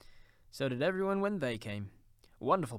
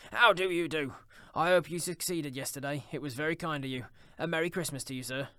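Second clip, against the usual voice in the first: raised, hearty, eager welcome; higher-voiced, delighted old Scrooge; effusive Scrooge, greeting; 4 semitones higher, 5 dB louder than usual. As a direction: greet him with rushing goodwill.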